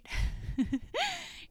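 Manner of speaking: a high, light laugh; a bright high giggle at herself. Real, not acted. Laughing at heavy content.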